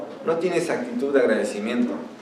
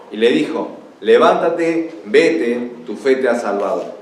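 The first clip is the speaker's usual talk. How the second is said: raised, emphatic verse reading with slowed phrases; scripture reading; 4 semitones higher, 3 dB louder than usual. Acted. Preacher reading Jesus' answer to the Samaritan, emphatic proclamation. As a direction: proclaim it, weighting each question. Reads the scripture passage aloud.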